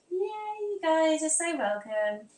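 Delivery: high, drawn-out cheer; gushing welcome. Pretend. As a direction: welcome them in a high, slow, playful squeal. Excited greeting to chat friend.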